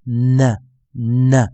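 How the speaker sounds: "呢" as a held N released into a schwa; isolated, drawn-out N sound on "呢"; stressed dark N. Shown as a demonstration. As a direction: model "呢" as a heavy "nnn-uh". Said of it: "我们会发音为呢".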